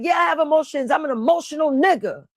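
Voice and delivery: high-pitched retold protest; reenacting an emphatic admission; heated comeback. Acting out own heated comeback.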